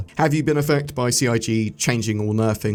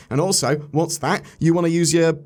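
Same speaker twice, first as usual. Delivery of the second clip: ad read; 7 semitones higher than usual. Acted. NordVPN ad read.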